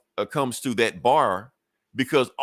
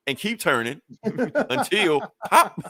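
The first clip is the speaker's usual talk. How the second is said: slow, high, breaking into laughter; amused laughing delivery; laughing through it; 10 semitones higher, slower than usual. Real, not acted. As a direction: giggle out the last words, drawn out. Laughing while retelling Lobo's line.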